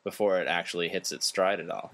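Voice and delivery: ordinary talk, nothing marked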